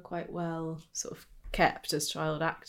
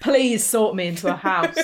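laughing plea; 3 semitones higher, 9 dB louder, slower than usual. Pretend. Mock begging the actor, laughing.

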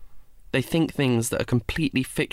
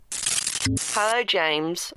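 put-on higher, whiny teen boy voice; whiny teenage boy; 6 semitones higher, much slower than usual. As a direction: whine it like a snotty teenager. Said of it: "I said in my whiny teenage boy voice".

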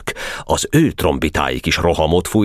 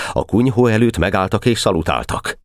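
usual speaking voice

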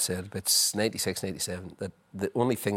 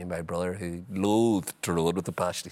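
ordinary talk, nothing marked

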